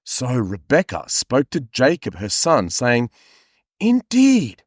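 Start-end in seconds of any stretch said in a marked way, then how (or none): 3.8-4.6 high, drawn-out conspirator voice; lofty, hushed scheming; whispery scheming voice. Acted. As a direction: lean in like a plotting mother. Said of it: "in a whispery voice at the moment".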